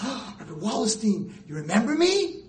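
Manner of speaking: high, loud, coy mock student; hammed-up gushing former student; eager girl. Former student greeting the rabbi, high and eager. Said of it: "they're like".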